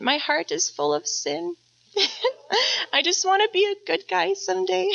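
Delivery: put-on fond laughter breaking into a child's words; laughing while quoting son. Mom quotes son, laughing.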